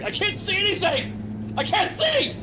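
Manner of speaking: high-pitched shriek, half laughing; panicked scream. Retold or imitated. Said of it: "the realistic way he screams".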